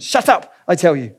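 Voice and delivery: mildly snarled shut-up; impatient snarl. Acted. Ghost snapping at the lizard on his shoulder, impatient snarl. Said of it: "with a snarl of impatience".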